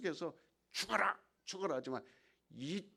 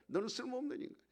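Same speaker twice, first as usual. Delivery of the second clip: higher, sneering repeat of the insult; taunting devil; 9 semitones higher, much faster than usual. Acted. Preacher voicing the devil at the despairing actor, high sneering taunt. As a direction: needle him with 쓸모없는 rising at the end. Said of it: "마귀가 공격하는 거야".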